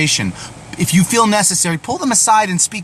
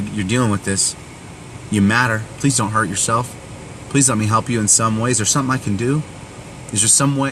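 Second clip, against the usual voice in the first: in kindness; 6 semitones lower, 4 dB quieter than usual. Retold or imitated. Speaking to a struggling friend about their pain, tender and pleading. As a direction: lower and softer, tender and pleading. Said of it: "respond in kindness and say".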